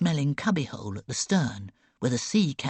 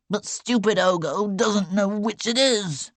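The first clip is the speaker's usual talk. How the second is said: higher, louder whiny complaint; petulant sick boy; 7 semitones higher, 5 dB louder than usual. A narrator's reading. Sick boy grumbles to girl, higher whiny complaint. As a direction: sulk and blame your friend.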